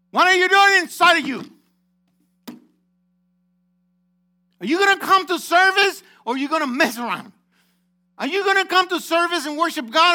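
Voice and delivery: high, full-voiced preacher's shout; confrontational shouting. Real, not acted. Preacher challenging congregation to serve God or mess around, shouting. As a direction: hammer each question at them, loud and high.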